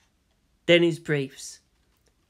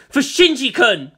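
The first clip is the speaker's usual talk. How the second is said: raised, loud battle cry; fierce cry; 7 semitones higher, 6 dB louder than usual. Acted. Woman attacking her kidnapper for the boy, fierce cry. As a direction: shout it as you strike.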